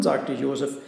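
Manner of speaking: ordinary delivery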